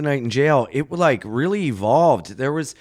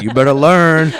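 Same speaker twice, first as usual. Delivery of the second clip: tough advice; 8 dB louder than usual. Retold or imitated. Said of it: "the guy's like".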